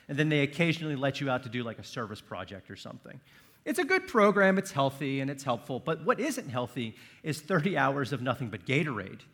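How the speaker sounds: normal talking voice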